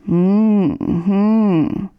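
drowsy closed-mouth hum; half-asleep mumbled hm; sleepy murmur. A narrator's reading. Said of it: "murmelte Möhnlein".

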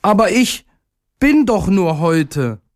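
high, despairing little voice; distressed, high-pitched plea; desperate cry. A narrator's reading. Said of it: "war ganz verzweifelt".

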